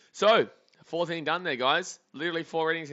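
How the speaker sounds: excited. Real, not acted. Streamer telling viewers the inning flew by, excited and loud. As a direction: hype the quick inning up. Excited about fast game.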